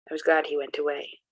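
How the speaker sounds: plain reading voice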